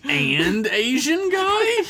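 high, drawn-out incredulous question; slow, high-pitched mock surprise; mock amazement. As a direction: stretch it upward in disbelief. Mock amazement at unlikely friends.

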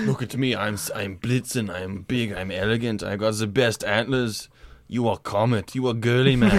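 put-on low, boastful German accent; German Blitzen. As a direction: sneer as a vain German strongman. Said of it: "Blitzen would be the German".

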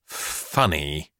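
low, soft, reluctant "Funny"; pompous reluctance, dropped in pitch and volume; barely able to say it. A narrator's reading. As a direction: force out "Funny" quietly with distaste. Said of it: "could barely bring himself to say the word".

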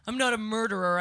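normal talking voice

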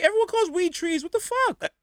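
very high-pitched comic indignation; incredulous objection. Retold or imitated. Arguing Bronx versus Brooklyn slang in cell, incredulous. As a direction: protest in a hammed-up high voice.

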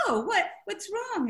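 high-pitched, innocent surprise; mock alarm. Author replying to her editor, in mock surprise. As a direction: ask it high, half laughing, as if caught out.

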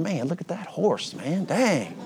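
soft, high, wistful gawking; a touch of hushed envy at a fancy horse; envious awe. Retold or imitated. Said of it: "you might be sitting on your donkey going".

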